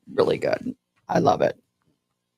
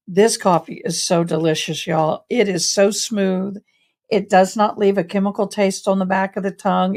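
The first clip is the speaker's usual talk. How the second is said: ordinary talk, nothing marked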